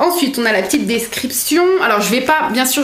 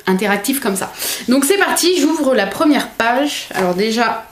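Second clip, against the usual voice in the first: normal talking voice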